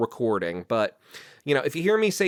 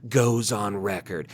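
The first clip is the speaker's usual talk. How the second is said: mock TV trailer announcer, deep and measured; TV spot announcer; 7 semitones lower, 3 dB quieter than usual. Host parodying the TV spot for the interview, deep announcer voice. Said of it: "the T V spot for it already".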